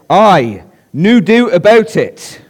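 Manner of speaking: a light Scottish Aye, then a lifted no doubt; Scottish accent. Retold or imitated. Pastor retelling the Scottish convert's reply, lightly Scottish. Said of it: "That's how he said it".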